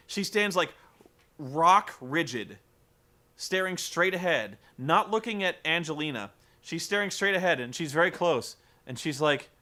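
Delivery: usual speaking voice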